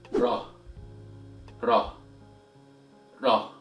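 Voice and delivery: crisp tapped Arabic R in "Ra". Shown as a demonstration. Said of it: "Repeat after me".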